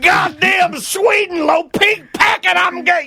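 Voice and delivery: high, slow mock rant; imitating angry dad. Son imitating dad raging at fake sugar, hammed-up shout. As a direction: ham up dad's fury over the sweetener. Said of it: "very angry that my mom had".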